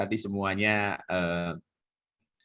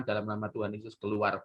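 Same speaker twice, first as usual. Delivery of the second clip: low, soft, quick command; understated, no show at all; quiet exorcist; 4 semitones lower, 6 dB quieter than usual. Retold or imitated. Minister just lays on hands, quiet exorcism. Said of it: "orang yang diem".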